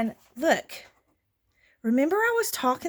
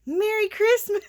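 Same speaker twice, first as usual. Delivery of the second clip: higher and louder, cheerful; happy quote; 11 semitones higher, 5 dB louder than usual. Retold or imitated. Friend answering the thank-you text, bright cheerful higher voice. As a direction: beam it out like a gift reveal. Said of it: "She said".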